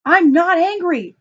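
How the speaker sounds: high, loud, drawn-out mock yell; angry screaming. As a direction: yell the denial like an irate husband. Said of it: "screaming still, and say".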